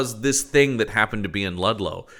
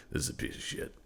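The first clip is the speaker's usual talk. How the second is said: muttering; 14 dB quieter, faster than usual. Retold or imitated. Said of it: "Duchovny is acutely aware".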